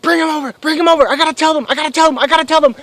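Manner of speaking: high, loud, rapid pleading; breathlessly eager high-pitched shouting; frantic excitement. Retold or imitated. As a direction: blurt it over and over, bursting to tell.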